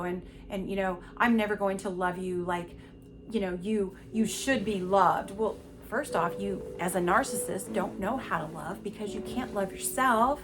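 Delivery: usual speaking voice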